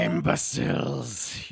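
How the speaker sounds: loud, slow, drawn-out roar; furious goblin boss. Acted. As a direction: bellow it in contempt at your underlings. Goblin boss bursts from shelter.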